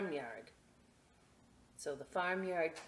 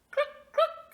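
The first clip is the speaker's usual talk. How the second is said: clucking hen; 10 dB louder than usual. Acted. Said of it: "said the chicken".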